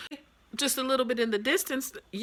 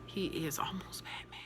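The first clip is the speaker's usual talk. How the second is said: awed whisper; 2 semitones lower, 11 dB quieter than usual. Acted. Praising Bruce's bravery in the fight, awed whisper. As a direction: breathe it like a secret.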